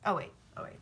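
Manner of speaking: ordinary delivery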